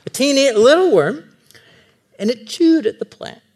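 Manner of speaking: put-on high, squeaky emphasis on teeny; playful high storytelling; teeny worm. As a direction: say it like something tiny. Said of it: "tiny worm emphasis".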